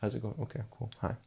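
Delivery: a mumbled greeting nobody will notice; half-hearted, quiet street-corner hello; timid seller mumble. Shown as a demonstration. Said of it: "And I'm just kinda going".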